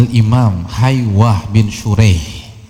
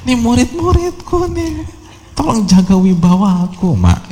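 mock scolding of mother. Embarrassed teacher complains to mother before his students, mock scolding. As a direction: imitate an embarrassed son protesting, pitch leaping up. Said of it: "Ngamarin ibunya".

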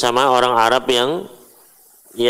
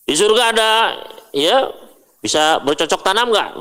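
higher, eager mock question to the Prophet; curious Bedouin question; 9 semitones higher than usual. Teacher imitates a Bedouin asking the Prophet, a light higher curious question. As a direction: play a naive Bedouin wondering aloud about paradise.

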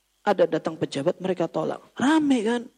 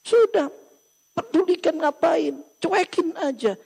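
high, louder brush-off; dismissive scoff; 9 semitones higher, 3 dB louder, slower than usual. Retold or imitated. Nephew tells Umar to ignore his foolish uncle, high and dismissive. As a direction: wave the uncle off.

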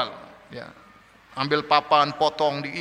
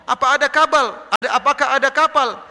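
raised, scoffing questions; mocking; 7 semitones higher, 3 dB louder than usual. Another scoffer questions Noah about his ship, mocking. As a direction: scoff at him, voice pitched up. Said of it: "Ada lagi ngejek".

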